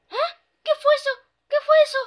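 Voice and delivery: high, loud startled cry. Acted. The pony hears the ghost and cries out startled, high and loud. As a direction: jump at the noise and cry out.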